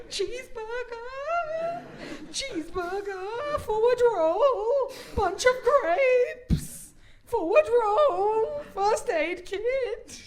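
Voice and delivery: high, slow, stretched loud cries; frantic wailing cries. Acted. Comic pacifying a Tamagotchi, slow high wailing cries. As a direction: wail each item out in mock despair.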